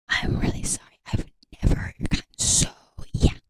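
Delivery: whispered fairy apology; whispering. Acted. Fairy apologises to a human visitor, whispering. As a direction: apologise in a full, hushed whisper. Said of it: "I whisper a lot".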